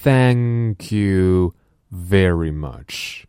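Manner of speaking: very slow, Thank with tongue between teeth; slow teacher demo; slow model phrase. Shown as a demonstration. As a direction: spell it out for the class. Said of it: "我们最常说的谢谢".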